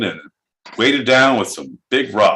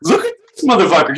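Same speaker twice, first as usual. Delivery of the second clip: excited jeer; 7 semitones higher, faster than usual. Real, not acted. Mocking the guest's look.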